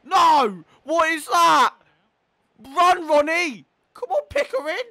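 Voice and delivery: very high, loud shouting; exasperated yelling. Real, not acted. Gamer blaming his keeper Tyson, exasperated yelling. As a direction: yell at the players in disbelief.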